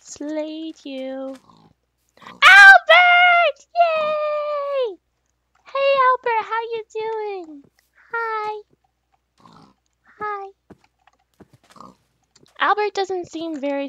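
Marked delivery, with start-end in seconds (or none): 2.2-5.0 very high, loud delighted cry; excited squeal. Real, not acted. Greeting a pet. 5.6-10.5 high sweet pet-talk voice. Real, not acted. Talking to the animal.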